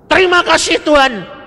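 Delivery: retold joyful shout. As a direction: burst out in grateful joy. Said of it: "langsung saya teriak". (teriakan syukur yang lebih tinggi)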